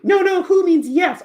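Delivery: high, loud, slow owl voice; hooty, drawn-out exasperated explanation; Snowy. Acted. Owl corrects the teacher, high, loud and slow. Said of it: "eventually Snowy will say".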